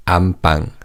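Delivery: 안방 with a tense, unvoiced pp, as am-ppang; tensed B. Shown as a demonstration. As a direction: tighten the second syllable of "안방" to ppang. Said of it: "the second syllable 방 is pronounced as bang".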